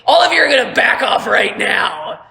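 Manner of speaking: loud, threatening command; high, forceful ultimatum; Captain Merrick. Acted. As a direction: shout down a crowd as a hostage taker. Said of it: "He's like".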